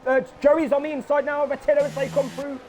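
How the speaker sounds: lively live play-by-play; excited sim racing commentator; race commentary. Acted. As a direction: call the race as it happens.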